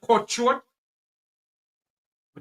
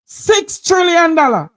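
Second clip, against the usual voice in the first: higher, louder, slow outraged cry; outraged shout; 7 semitones higher, 8 dB louder than usual. Real, not acted. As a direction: bellow the sum in disbelief.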